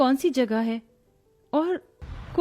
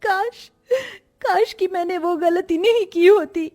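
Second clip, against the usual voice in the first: sobbing self-reproach; crying with remorse; tearful regret. Acted. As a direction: curse yourself through tears, voice raised. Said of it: "खुद में ही कोस रही थी".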